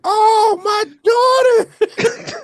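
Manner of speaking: high, loud mock shriek; imitated background scream. Quoting the guy in the back of Harold and Kumar, mock scream. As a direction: scream it like an over-dramatic film extra. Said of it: "somebody screaming in the background of a movie".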